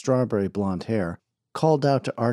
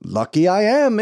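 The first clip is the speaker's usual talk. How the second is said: pompous snake-oil salesman; 10 semitones higher, 7 dB louder than usual. Acted. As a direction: sell the crowd a tall tale like a showman.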